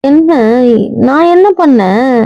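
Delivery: high, drawn-out plea of innocence; innocent protest. Acted. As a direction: protest slowly, pitched well up, rising at the end. Wife protests at husband's anger.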